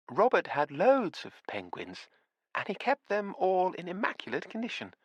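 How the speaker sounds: put-on a kindly old widow amazed at her own books; surprised old lady. A narrator's reading. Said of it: "with a note of surprise in her voice".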